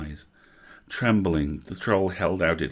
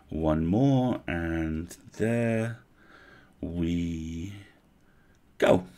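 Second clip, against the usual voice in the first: soothing murmur; much slower than usual. Acted. Elf soothes while stitching.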